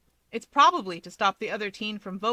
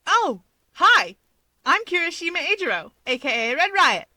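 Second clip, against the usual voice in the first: high, loud cheerful intro; a loud eager hero introducing himself to the room; excited greeting; 9 semitones higher, 8 dB louder than usual. Acted. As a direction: burst in with a loud, high hello.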